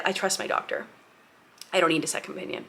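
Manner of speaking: near-whispered, quietly certain; hushed firm reply. Retold or imitated. Telling her doula softly but firmly, hushed. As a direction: say it low and settled, almost under the breath.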